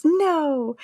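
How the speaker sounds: high, drawn cry without much volume; mock yell. A narrator's reading. Buzz yells as Woody loses grip, mock yell. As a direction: cry out in hammed-up protest. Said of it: "Buzz yells".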